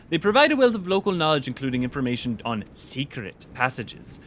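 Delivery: theatrical, formal servant voice with swooping pitch; Jabrolka. Acted. Servant of Lady Alice introducing themself to an adventurer, in a lilting put-on voice. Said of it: "easier to do that voice".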